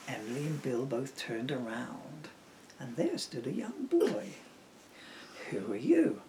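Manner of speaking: soft storybook read-aloud; soft rhyming bedtime reading; reading to children. Acted. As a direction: lull them into the story gently. Reads rhyming picture book aloud.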